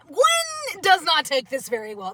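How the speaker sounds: usual speaking voice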